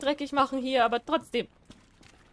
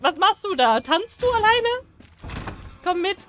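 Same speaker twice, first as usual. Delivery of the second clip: normal talking voice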